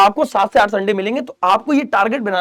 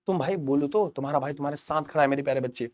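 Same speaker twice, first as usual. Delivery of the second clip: low, soft big-brother reassurance; tender reassurance; 6 semitones lower, 9 dB quieter than usual. Real, not acted. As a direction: drop your voice to promise you are there. छात्र को साथ का भरोसा. (नरम, धीमे स्वर में भरोसा)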